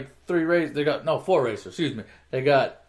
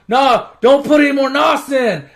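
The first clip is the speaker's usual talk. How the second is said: high, loud mock alarm; laptop's warning; 8 semitones higher, 7 dB louder than usual. Laptop warns the driver, high loud mock warning. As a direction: raise pitch and volume as an urgent warning. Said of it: "the laptop is telling him".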